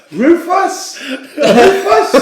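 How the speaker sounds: high rising mock call of a name; calling a pet's name. Mimicking the vet calling a dog from the waiting room, high and rising.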